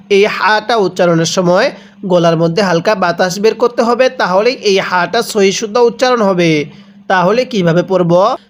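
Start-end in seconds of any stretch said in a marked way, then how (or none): none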